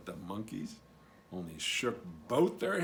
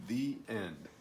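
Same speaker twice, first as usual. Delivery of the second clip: ordinary delivery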